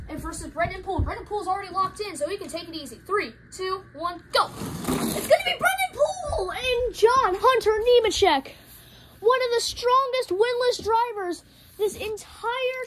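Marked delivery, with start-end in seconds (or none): 4.5-12.8 high, drawn-out commentator shout; excited race call. Real, not acted. Commentator announcing who is knocked out, excited. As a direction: shout the winner's name over the finish. Calling the diecast race finish.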